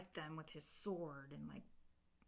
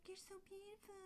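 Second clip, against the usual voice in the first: fairy voice; 10 dB quieter, faster than usual. Retold or imitated. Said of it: "they're just like".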